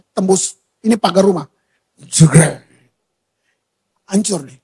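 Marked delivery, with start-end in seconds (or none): 2.0-2.7 low, loud smashing crash noise; a sudden imitated smash. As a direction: bark out the crash like metal hitting. Motorbike smashing through fence.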